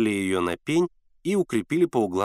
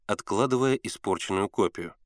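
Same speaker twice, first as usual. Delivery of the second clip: plain reading voice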